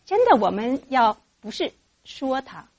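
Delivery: ordinary talk, nothing marked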